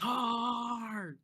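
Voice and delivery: high excited call; shouted Tar. Real, not acted. Player cries out his choice of tar, high and excited. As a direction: yell the choice out.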